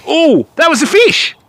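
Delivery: sudden high yelp of delight; thrilled at the strike, voice jumping up; excited. Real, not acted. Angler telling stream viewers a fish just struck, excited.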